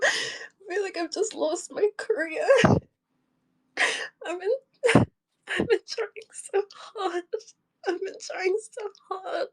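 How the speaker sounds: real tearful despair; crying. Real, not acted. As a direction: force the words out through tears. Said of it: "I'm just crying".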